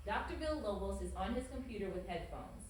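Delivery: usual speaking voice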